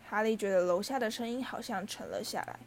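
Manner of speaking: ordinary delivery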